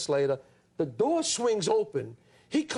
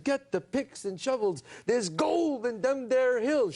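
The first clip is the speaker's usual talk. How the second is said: slow, drawn-out western line, a bit higher; audition reading; 5 semitones higher, slower than usual. Retold or imitated. Young actor auditioning for a casting director, slow and hammy. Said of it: "So I said".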